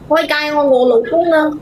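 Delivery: ordinary delivery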